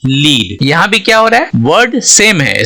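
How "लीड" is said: "लीड" said with a long ee, like leed; "लीड" pronounced leed; lead as guide. Shown as a demonstration. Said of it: "इसको बोलेंगे लीड".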